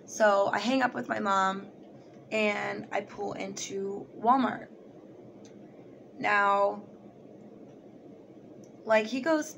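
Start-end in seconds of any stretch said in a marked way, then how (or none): none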